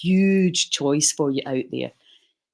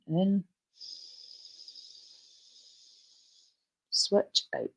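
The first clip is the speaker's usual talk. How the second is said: guided breathing cues; 4 semitones higher, 5 dB quieter, slower than usual. Acted. Leads breathing rounds.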